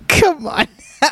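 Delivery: high, laughing come on now; laughing disbelief. Real, not acted. Scolding viewers for a cheeky question, laughing.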